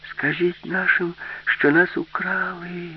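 high, drawn-out call for help; girl crying out. A narrator's reading. Alisa begs the dolphins to tell her people, high drawn-out cry. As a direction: call it slowly across the water, pitched high. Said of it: "крикнула Аліса".

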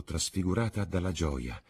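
usual speaking voice

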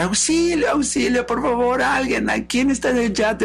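high, pleading calls; theatrical cry for help. Acted. Love calling to the passing yacht, theatrical cry. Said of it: "empieza a gritar".

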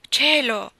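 loud shocked "Cielo!" with a falling end; shocked exclamation. A narrator's reading. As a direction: cry it out, shaken.

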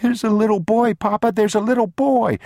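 put-on high, loud, pleading child voice; crying little boy. A narrator's reading. Boy telling papa what he saw, high tearful insistence. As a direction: plead excitedly as an upset small boy. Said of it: "standing there crying".

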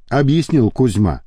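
ordinary delivery, nothing marked

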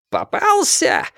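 high-pitched triumphant squeal; squealed cry. A narrator's reading. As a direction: squeal it at the man you caught. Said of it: "взвизгнул капитан".

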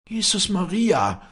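high, loud, drawn-out shriek of horror. A narrator's reading. Old nurse finds the torn rosary, shrieking. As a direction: cry out slowly, high in alarm. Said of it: "kreischte die Luckhardt".

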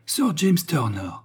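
slow, high exclamation of recognition; astonished cry. A narrator's reading. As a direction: cry out the name in surprise. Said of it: "s'écrièrent en chœur les trois visiteurs". (exclamation de surprise, voix haute)

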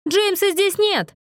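high, drawn-out call through a door. A narrator's reading. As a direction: call it slowly to someone outside. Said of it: "крикнул Мэтью, подходя к двери".

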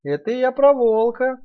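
high slow piglet. Acted. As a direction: answer high and steady. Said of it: "ответил Наф-Наф".

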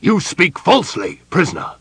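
loud, high furious accusation; enraged general's rebuke; hollow, furious. A narrator's reading. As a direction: denounce the captive in fury. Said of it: "in a hollow, furious voice".

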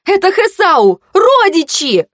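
high, urgent exclamation; triumphant blurting. A narrator's reading. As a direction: blurt out the answer, pitched high. Flustered sister finds her answer. (выпалить высоко, с жаром)

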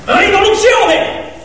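slow, high, ringing proclamation; drawn-out rallying shout; triumphant cry. A narrator's reading. Climax of the animals' revolt.

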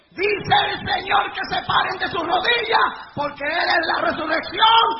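slow, high, shouted announcement; shouted proclamation. Real, not acted. Preacher tells the kneeling crowd to rise, shouted proclamation. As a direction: proclaim it to the whole hall.

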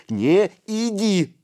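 high, loud, drawn-out plea; shrieked warning from a small boy; ringing cry. A narrator's reading. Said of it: "звонко крикнул мышь".

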